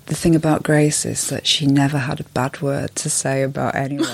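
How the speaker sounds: mock funeral tribute, delivered deadpan. Friend standing up to speak at a funeral, deadpan. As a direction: deliver the eulogy drily, giving nothing away. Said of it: "say it in a really deadpan way".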